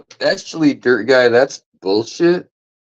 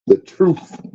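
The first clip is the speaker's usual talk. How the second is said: high rising "truth"; Joey's catchphrase; 9 semitones higher than usual. Retold or imitated. Quoting Joey's line, pitched up and rising. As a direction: toss off "The truth" up high like Joey. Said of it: "as Joey would say".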